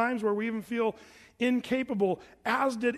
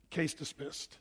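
soft verdict; 5 semitones lower, 8 dB quieter, slower than usual. Retold or imitated. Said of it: "he says".

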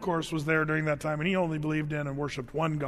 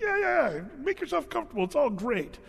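much higher, dismissive yeah-yeah; sarcastic Isaiah; 9 semitones higher than usual. Retold or imitated. Isaiah mocking the doomed people, sarcastic cheer. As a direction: brush them off with mock cheer. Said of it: "kinda sarcastic".

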